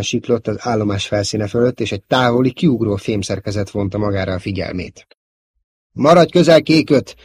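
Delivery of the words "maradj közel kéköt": loud, raised military order; commanding order. A narrator's reading. Squadron commander instructing his pilot to stay close, commanding. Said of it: "utasította a századparancsnoka".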